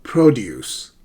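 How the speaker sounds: "produce" stressed on its first syllable, as a noun. Shown as a demonstration. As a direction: hit the PRO in "produce". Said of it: "stress the correct syllable".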